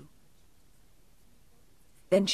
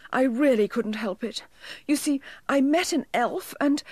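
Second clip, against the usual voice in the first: nearly crying; 6 semitones higher, 3 dB louder than usual. A narrator's reading. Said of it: "nearly crying".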